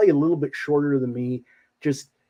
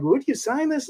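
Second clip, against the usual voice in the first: very high, timid fan voice; shy fan; 12 semitones higher than usual. Retold or imitated. Fan asks Mojo for an autograph, high and timid. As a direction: ask sweetly like a starstruck kid. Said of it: "And I'm like".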